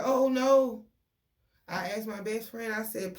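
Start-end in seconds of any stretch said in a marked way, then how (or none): 0.0-0.8 a sudden loud, high cry of dismay; retold shout of alarm. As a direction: blurt it out, louder and higher. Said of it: "So I'm like".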